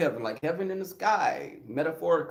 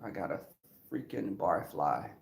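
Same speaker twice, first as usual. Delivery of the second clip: muttered low and soft under the breath; low, soft inner grumble; inner thought; 11 semitones lower, 8 dB quieter than usual. Retold or imitated. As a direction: grumble it privately to yourself, much lower. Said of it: "I was thinking".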